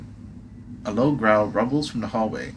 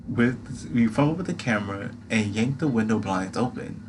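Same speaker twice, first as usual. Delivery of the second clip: ordinary delivery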